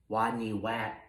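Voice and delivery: soft, shy near-whisper. A narrator's reading. Shy rat whispers his name to teasing rodents. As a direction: barely let it out, ashamed of his name. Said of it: "whispered Wadney".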